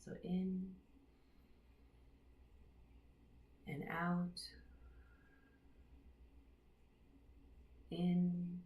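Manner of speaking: unhurried meditation voice with long pauses; guided meditation. Real, not acted. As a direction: guide them gently into their breath, leaving space between phrases. Leads a breathing meditation.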